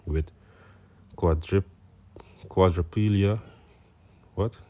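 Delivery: ordinary talk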